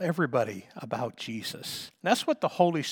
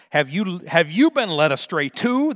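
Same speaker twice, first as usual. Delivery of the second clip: loud, high mocking retort; 4 semitones higher, 10 dB louder than usual. Pharisees mocking the guards who returned empty-handed, loud sneer. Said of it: "retorted back in a mocking tone".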